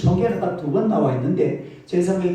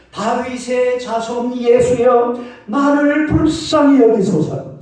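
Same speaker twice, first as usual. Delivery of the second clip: slow, higher pleading cry to Jesus; 5 semitones higher, 4 dB louder, slower than usual. Retold or imitated. Blind Bartimaeus crying out to Jesus, slow pleading shout. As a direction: drag it out, begging for mercy. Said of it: "막 꽝을 쳤습니다".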